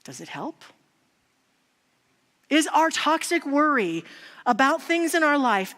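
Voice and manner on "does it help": soft question. Real, not acted. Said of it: "And I ask us today".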